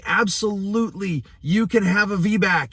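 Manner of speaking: bright high falsely cheerful reassurance; imitating an overeager doctor promising a VBAC; mock provider promise. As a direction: sell the empty promise sweetly. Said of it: "You don't say".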